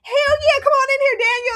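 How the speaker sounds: high, loud, laughing yes; overjoyed, laughing, jumping at the offer; gretig ja zeggen. Retold or imitated. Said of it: "I said".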